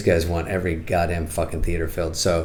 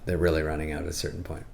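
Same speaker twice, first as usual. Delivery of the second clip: normal talking voice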